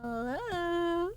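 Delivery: high, trembling hello; nervous call. Real, not acted. Streamer calls out timidly to the game nurse.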